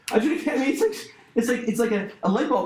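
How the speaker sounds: carried away, pitch up; still high-pitched. Real, not acted. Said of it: "My voice got high".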